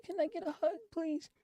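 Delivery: high, tearful begging voice; small choked-up voice asking for comfort; crying plea. Retold or imitated. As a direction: plead through tears for a hug. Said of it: "I just started crying".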